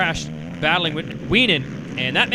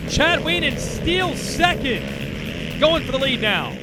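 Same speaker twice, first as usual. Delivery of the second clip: motorsport commentator; 3 semitones higher than usual. Acted. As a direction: call the pass as it happens. Live race call, pitch up.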